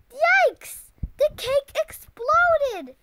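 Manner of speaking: high, loud shocked yelp; loud high alarmed cry. Acted. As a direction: yelp at the exploding cake. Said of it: "yelped Froggy's father".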